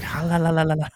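high, hammed-up gibberish; mock tongues babble; speaking in tongues. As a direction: babble la la like Borat in church. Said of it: "he starts speaking in tongues".

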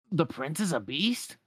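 slow, puzzled duck voice; a confused duck asking slowly; Donald voice. Acted.

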